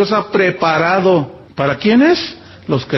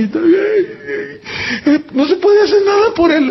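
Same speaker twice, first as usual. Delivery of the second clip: choked-up news; 12 semitones higher, slower than usual. Retold or imitated. Retelling tragic news, crying.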